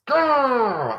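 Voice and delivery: high, playful growl of frustration; frustrated growl. Real, not acted. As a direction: let the annoyance out as a growl. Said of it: "made me so freaking frustrated".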